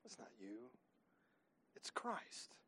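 near-whispered, soft answer; hushed whisper. Real, not acted. Preacher telling the church where power comes from, whispered. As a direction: drop to a whisper to land it. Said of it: "hushed climax".

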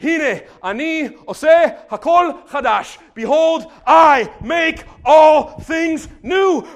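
high, loud, very slow shout; enthroned voice shouting. Retold or imitated. God on the throne declaring renewal, slow loud shout. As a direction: stretch each word like a decree. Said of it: "he who was seated on the throne said".

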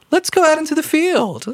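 high, sweetly friendly invitation; nice and pleasant. Acted. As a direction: sound falsely friendly. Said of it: "nice and pleasant".

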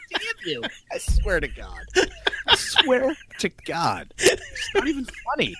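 mock fury breaking into laughter; anger turning to laughter. Real, not acted. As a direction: curse through helpless high laughter. Caught by friend's joke.

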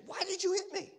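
very high, squeaky-pitched protest; acted indignant protest. Preacher acting out being hit, high mock-outraged protest. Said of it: "I'd say".

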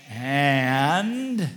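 loud, long-held "and" swooping upward; prompting interruption. Retold or imitated. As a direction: mimic a pointed wifely prompt. Re-acts the wife's prompting interruption.

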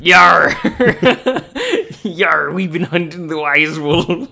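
laughing, loud pirate growl; hammed-up salty sea dog, laughing; old sailor. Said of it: "Salty sailor".

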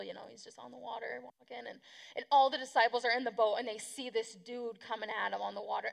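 usual speaking voice

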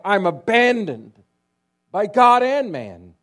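a dying man's loud despairing cry. Retold or imitated. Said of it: "he cried out".

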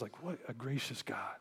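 awed hushed whisper, almost no voice; whispered marvel at grace. Real, not acted. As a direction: breathe it out in wonder. Awed aside, voice drops to whisper.

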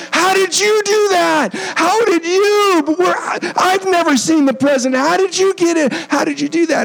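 high, whiny mock jealousy; mocking jealous colleagues, high and whiny; jealous missionaries. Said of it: "they got all jealous and they said".

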